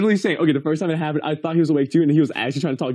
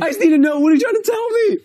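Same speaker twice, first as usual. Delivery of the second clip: high, loud mock desperation; hammed-up begging for an answer, laughing; exasperated plea; 5 dB louder than usual. Friend retelling pleading with a sleep talker, hammed-up exasperation.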